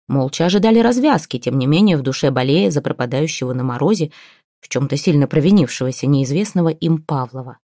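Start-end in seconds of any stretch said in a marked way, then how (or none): none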